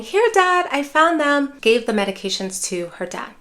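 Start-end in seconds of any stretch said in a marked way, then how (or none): 0.0-1.5 cheerful find. Retold or imitated. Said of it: "pulling them out and saying".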